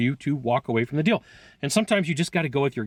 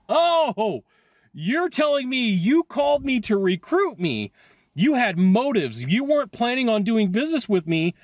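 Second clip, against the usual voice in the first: sarcastic angry comeback, pitched higher and slow; mad retort; 7 semitones higher than usual. Retold or imitated. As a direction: replay the moment you caught them out, mock-polite and fed up. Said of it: "I was mad".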